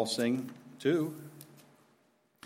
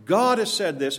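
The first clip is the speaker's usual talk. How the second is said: loud, higher-pitched bold declaration; courageous proclamation; 6 semitones higher, 6 dB louder than usual. Shown as a demonstration. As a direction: proclaim it boldly, hitting the first word hard. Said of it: "Having the courage to say".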